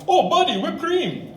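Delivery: imitating the Indian clerk's accent, cheerful. Indian clerk beaming at customer, Indian accent. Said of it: "that's exactly how he talks".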